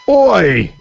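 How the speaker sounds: high, loud Commonwealth "Oi!"; sharp Commonwealth-accent shout; general Commonwealth accent. Shown as a demonstration. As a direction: call out a mock Commonwealth "Oi!". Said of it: "which is just general Commonwealth".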